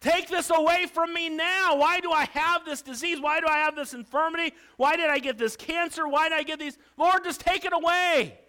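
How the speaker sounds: loud, high, whiny demands; mock an impatient believer's complaints; demanding prayer. Believers nagging God for healing, loud and whiny. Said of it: "we come to God and we're like".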